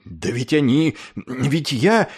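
mild flustered cluck; a touch of helpless spluttering. A narrator's reading. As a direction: start to protest in broken bits. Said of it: "закудахтал было Клевенджер".

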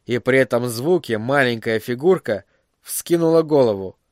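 usual speaking voice

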